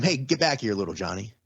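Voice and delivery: ordinary delivery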